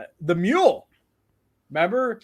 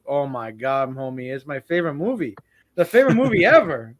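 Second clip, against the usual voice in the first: retold gushing in a Mexican accent; thick Mexican accent; 3 semitones lower, 4 dB louder than usual. Friend raves about his favorite movie, thick Mexican accent. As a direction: imitate a Mexican friend, building louder at the end. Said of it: "very thick accent".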